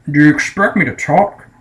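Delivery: lightly voiced Bond, questioning rise; Sean Connery impression. Reviewer repeating Bond's famous line, light Connery impression. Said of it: "And Sean Connery goes".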